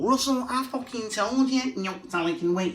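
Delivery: put-on high, angry Manchester accent shouting at Russell. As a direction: scold like a furious Mancunian wife. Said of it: "what's living with the Manc accent like".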